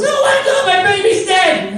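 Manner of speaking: very high, loud shrieking mother; panicked mom. Retold or imitated. Mom finding her son knocked out, shrieking in panic. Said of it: "she goes".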